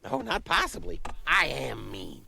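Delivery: cheeky goose voice; a proud, cheeky goose. Acted. Goose character in skit.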